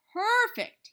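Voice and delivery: high, loud delight; excited cry. A narrator's reading. The boss lifting Fudge up, loud excited cry. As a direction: burst with delight. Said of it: "he cried".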